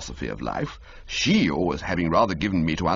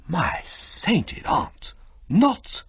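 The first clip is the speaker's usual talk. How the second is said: slow breathy gasp, then higher cut off; stunned realisation; aghast exclaim; 11 semitones higher, much slower than usual. Acted. Bertie to Jeeves, slow breathy aghast gasp.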